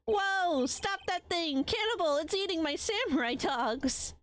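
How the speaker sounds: panicked vendor yell. Acted. Vendor yelling as dog eats.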